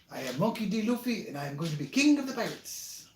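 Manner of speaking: high, bold anime hero declaration; Luffy voice. Retold or imitated. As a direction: proclaim it like a cocky pirate captain.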